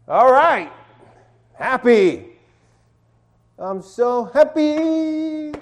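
high, slow, gushing so happy; hammed-up joy, high and slow; mock happy. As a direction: stretch it out like a giddy kid. Acting out the happy slide.

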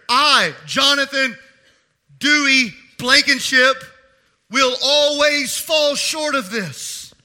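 loud, slow, ringing pulpit delivery; full-force preaching. Acted. Preacher to congregation, loud and slow. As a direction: proclaim it to the whole church.

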